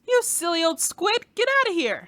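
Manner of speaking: shooing yell. Acted. Shooing the squid away.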